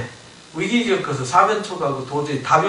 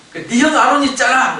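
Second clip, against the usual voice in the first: louder, higher imitated retort; insistent retort; 8 semitones higher, 5 dB louder than usual. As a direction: push back at the excuse, voice raised. Tuhan menjawab dalih Musa dengan tegas.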